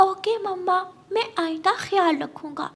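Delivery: put-on high-pitched child's voice; obedient child. Chick promising mother to behave, obedient. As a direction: agree like a well-behaved youngster.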